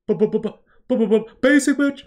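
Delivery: high, loud stuttered build into basic; a loud goofy rapper impression; imitating the rapper. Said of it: "he's like".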